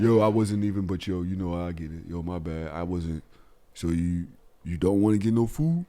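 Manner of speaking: low, soft, sheepish mumbling; acting a cowed man backing down quietly; sheepish apology.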